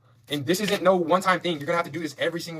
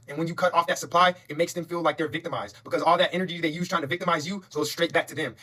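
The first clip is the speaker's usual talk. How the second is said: ordinary talk, nothing marked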